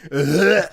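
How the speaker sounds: loud high ugh of disgust. Retold or imitated. Friend recoiling at sucking crawfish heads, loud ugh. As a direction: recoil at the idea. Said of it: "And I'm like".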